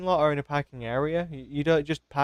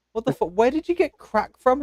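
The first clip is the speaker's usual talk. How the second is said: imitating shocked Brian; 10 semitones higher, 4 dB louder than usual. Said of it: "He goes".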